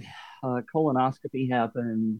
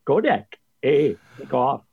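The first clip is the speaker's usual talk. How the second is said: put-on Canadian accent. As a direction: play it up Canadian. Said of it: "unless they're from Canada".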